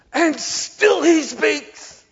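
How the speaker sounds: very high, loud, slow outburst; exasperated shout. Retold or imitated. Old lady protesting the long sermon, drawn-out shout. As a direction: cry it out in mock despair at the droning preacher.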